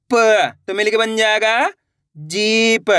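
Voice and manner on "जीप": जीप with the ee stretched long; जीप, held long for learners; drawn-out long vowel. Shown as a demonstration. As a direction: pull out the ee in जीप. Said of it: "तो आपको खींच के पढ़ना है".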